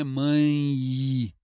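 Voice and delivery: MÃE ending in a long loud iii; stretched i glide. Shown as a demonstration. Teacher proving the E sounds like I, MÃE as mãiii. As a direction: hold the final i sound of mãe. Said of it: "o som de I".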